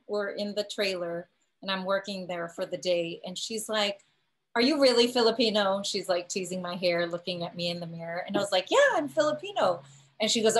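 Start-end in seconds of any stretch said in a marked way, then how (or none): 4.5-5.8 louder, higher skeptical ask; probing, raised-voice question; retold incredulous question. Retold stylist questioning her. 8.7-9.8 high, loud insistent reply; emphatic, raised-voice yes; retold indignant affirmation.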